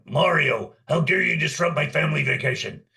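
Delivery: villain's outraged roar at Mario; Scott Burns. Acted. Voice actor redoing an audition line, as an indignant Bowser impersonation. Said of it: "did my best Scott Burns impersonation".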